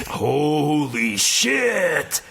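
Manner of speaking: very slow, drawn-out stunned exclamation. Acted. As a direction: let it crawl out in disbelief. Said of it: "said a stunned Swordborn".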